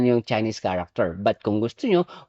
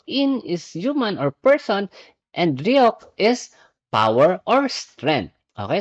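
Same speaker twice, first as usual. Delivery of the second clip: usual speaking voice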